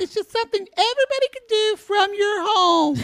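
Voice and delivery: very high-pitched put-on voice; quoting a woman. Host mimicking a woman's pitch, high put-on voice. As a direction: imitate her in a squeaky falsetto. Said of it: "she also says".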